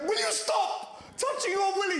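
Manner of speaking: mother yelling at her little son; mum telling off. Retold or imitated.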